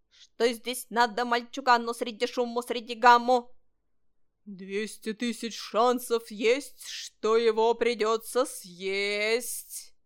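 loud, chanted threat with stretched endings; menacing mock ghost. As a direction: chant the rhyme like a spook. (громкое протяжное пугающее заклинание)

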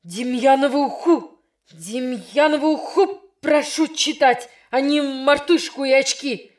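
громкий возмущённый крик; учитель кричит на класс; крик не своим голосом. Acted. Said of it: "закричал не своим голосом учитель". (закричал не своим голосом)

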